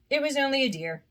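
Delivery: usual speaking voice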